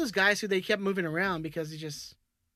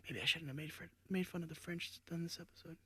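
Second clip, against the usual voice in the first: sheepish whisper; 14 dB quieter than usual. Real, not acted. Regretting joke on the French, whispered. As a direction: whisper it guiltily. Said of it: "I don't know if we've ever whispered like this".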